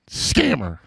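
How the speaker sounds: mock proud deadpan; deadpan son. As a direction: drop it dry and matter-of-fact. Son's deadpan answer retold.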